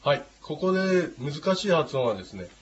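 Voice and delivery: ordinary talk, nothing marked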